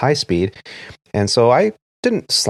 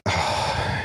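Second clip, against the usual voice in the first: soft groan; 8 dB quieter than usual. Retold or imitated. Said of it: "I was like".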